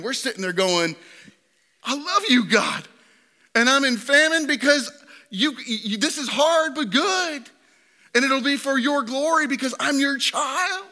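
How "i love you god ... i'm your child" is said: raised pleading prayer voice. Pretend. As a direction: voice lifted high, earnest and pleading. Voiced prayer of trusting believer.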